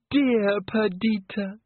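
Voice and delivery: a brave smile you can hear, overdone; brave little diva. Acted. Christine, affected diva voice.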